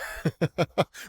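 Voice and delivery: short amused chuckle; light little laugh. Real, not acted. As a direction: laugh briefly to yourself. Chuckles after reading a quote.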